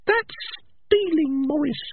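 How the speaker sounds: tiny, very high rat voice; Peaches. Acted. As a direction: squeak a small reproach. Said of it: "the voice of Peaches".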